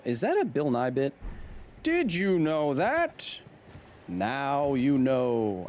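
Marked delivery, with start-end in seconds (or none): 1.8-5.6 drawn-out, hammed-up TV catchphrase; show catchphrase. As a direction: stretch each word like a cheesy science-show host. Repeats TV catchphrase, exaggerated.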